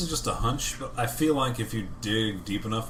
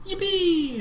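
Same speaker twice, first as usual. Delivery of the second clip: put-on much higher squeaky kid cheer; young Anakin. Imagining young Anakin cheering, a high kid's yippee. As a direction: yelp it like little Anakin. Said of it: "like, Anakin, like, saying".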